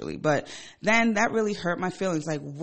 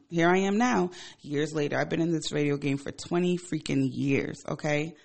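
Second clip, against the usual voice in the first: usual speaking voice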